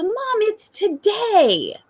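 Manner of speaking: put-on very high, slow singsong child voice; excited little girl. Little girl telling mom it's today, excited. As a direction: mimic a toddler's wide-eyed wonder. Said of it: "she's so excited".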